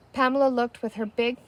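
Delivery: ordinary delivery, nothing marked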